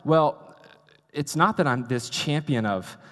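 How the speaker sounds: usual speaking voice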